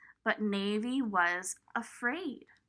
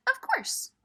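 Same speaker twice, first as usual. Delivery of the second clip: higher, bright friendly reply; lightly voiced kind girl; cheerful Lemon; 12 semitones higher than usual. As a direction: answer sweetly as Lemon.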